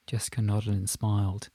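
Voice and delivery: usual speaking voice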